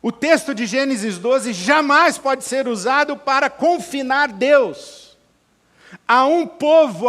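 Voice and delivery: loud, high-pitched sermon proclamation; full-force preaching. Acted. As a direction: thunder it out to the congregation, punching the key words.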